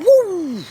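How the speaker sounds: very high, loud whoosh; whooshing woo. Retold or imitated. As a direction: mimic the horseshoe flying by. Horseshoe flies past.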